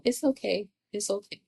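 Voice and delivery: quiet self-comfort, fading away; soft self-soothing. Shown as a demonstration. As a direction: hush it to yourself gently. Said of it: "I gotta sit here and go".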